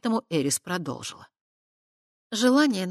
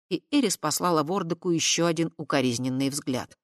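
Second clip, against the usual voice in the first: ordinary delivery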